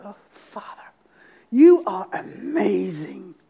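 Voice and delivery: breathed opening, then slow, low wonder; passionate awe. Acted. Worshipper praying to the Father as he wants to hear, in passionate awe. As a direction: whisper the address, then draw out the praise with passion. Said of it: "Put some passion".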